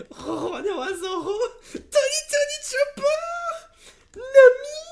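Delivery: very high, loud squeals of joy; ecstatic screaming. Real, not acted. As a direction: shriek each name in tearful delight. Reveals favourite cards, overjoyed.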